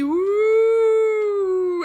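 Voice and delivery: long, loud high wind whoosh; rising gusty woo; howling wind. A narrator's reading. The wind blows stronger.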